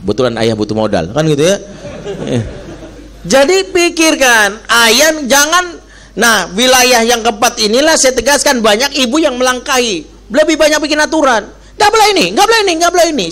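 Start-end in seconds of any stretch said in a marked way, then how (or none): 1.6-2.9 quiet airy chuckle; soft breathy laugh. Real, not acted. Preacher chuckling at his own joke to the audience, soft laugh. 11.8-13.3 nagging mother. Pretend. Preacher mimicking a bossy mother scolding her child, high nag.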